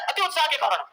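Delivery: usual speaking voice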